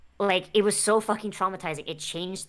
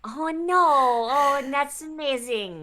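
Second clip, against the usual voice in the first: excited; 8 semitones higher, 6 dB louder than usual. Real, not acted. Reacts to a touching story.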